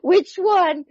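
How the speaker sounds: high-pitched, through laughter; laughing question. Real, not acted. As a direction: squeeze the question out while laughing. Laughing at grandson remark, retold.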